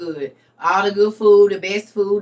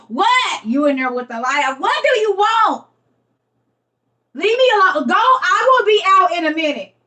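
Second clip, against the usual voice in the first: high, loud yell through the door; yelling from the bathroom; 8 semitones higher, 4 dB louder than usual. Pretend. On the toilet mid-cleanse, yelling at someone knocking. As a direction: shout someone away from the toilet.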